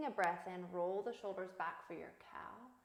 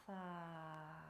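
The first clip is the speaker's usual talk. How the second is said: slow soft open-mouthed breath out; soft exhale; 4 semitones lower, 12 dB quieter than usual. Shown as a demonstration. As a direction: let the air fall out softly. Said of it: "slowly release that breath from your mouth".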